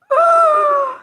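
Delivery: high, loud shocked gasp; sharp high shocked intake of breath. Real, not acted. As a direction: gasp in sudden alarm. Shocked at chat.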